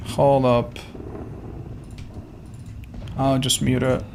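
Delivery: normal talking voice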